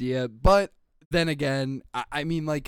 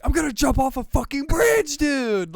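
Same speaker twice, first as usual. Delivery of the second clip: loud high frustrated yell; raging yell; 10 semitones higher, 6 dB louder than usual. Retold or imitated. Streamer retelling his gamer rage, yelling. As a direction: yell it like you've lost it. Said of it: "from the first clip where I'm like".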